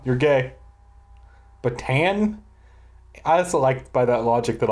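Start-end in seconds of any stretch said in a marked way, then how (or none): none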